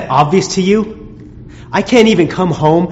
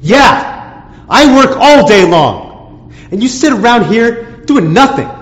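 louder, higher, slow and hammering each word; angry shout; 6 semitones higher, 3 dB louder, slower than usual. Acted. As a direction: yell it back at her. Husband shouting back in quarrel.

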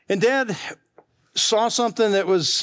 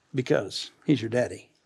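tender; 8 semitones lower, 6 dB quieter than usual. Real, not acted. Tender sermon point.

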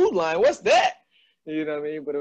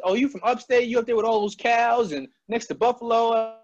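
high, teasing outsider voice; a hint of a condescending downstate voice; mocking outsider; 10 semitones higher than usual. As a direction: mimic a clueless outsider, lightly mocking. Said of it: "so many people had their own opinions".

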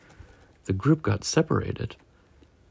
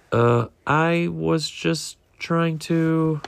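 slow, higher, hesitant excuse; nervous; 7 semitones higher, much slower than usual. A narrator's reading. Ward is confronted by the other fish and excuses himself nervously. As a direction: stall with a drawn-out, faltering excuse. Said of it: "He suddenly felt nervous".

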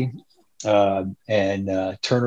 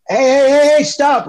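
calling out; 5 dB louder than usual. Retold or imitated. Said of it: "I'm like".

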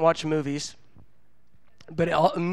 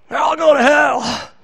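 loud, high comic cry of despair; exasperated outburst; 9 semitones higher, 8 dB louder than usual. Acted. Youth minister despairing over his youth group, exasperated outburst. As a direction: throw it out loud and high, fed up.

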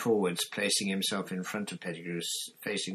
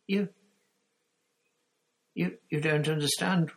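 high, halting whine; whining; 9 semitones higher, 5 dB louder than usual. A narrator's reading. Pettigrew protests to Sirius, whining. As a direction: stammer in helpless protest. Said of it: "whined Pettigrew".

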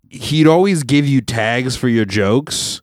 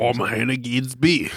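put-on broad, joking Australian accent; Hannah Gadsby; 6 dB quieter than usual. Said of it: "take anyone with an Australian accent".

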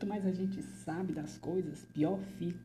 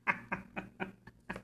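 laugh; 4 dB quieter than usual. Acted. Laughs after the joke.

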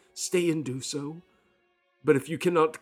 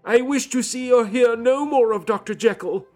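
much higher and louder, voice wavering; loud unsteady voice; 9 dB louder than usual. Acted. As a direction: cry it out, shaken and overwrought. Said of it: "in a loud, unsteady voice".

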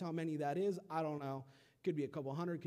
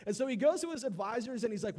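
ordinary talk, nothing marked

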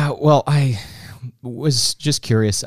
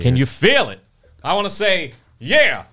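hammed-up revival preacher, higher; mock preacher; 8 semitones higher, 3 dB louder than usual. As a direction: feel the spirit, mock fervour.

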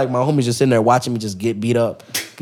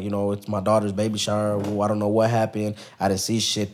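mild mom voice; 5 semitones lower, 7 dB quieter than usual. Retold or imitated. Mom answering police.

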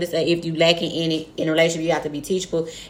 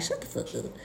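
low, much softer muttered dismissal; muttered shut up; 4 semitones lower, 14 dB quieter than usual. Retold or imitated. Tuning out her dude, muttering low and soft. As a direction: say it under your breath, shutting him out. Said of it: "And I'm like".

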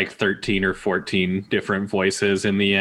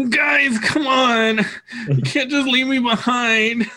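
whiny yeti; 14 semitones higher than usual. Shown as a demonstration. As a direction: whine like a sulky big yeti. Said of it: "He's super whiny".

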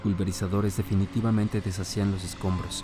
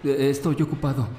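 said out loud; 6 semitones higher than usual. Acted. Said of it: "dijo en voz alta".

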